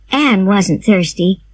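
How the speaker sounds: plain reading voice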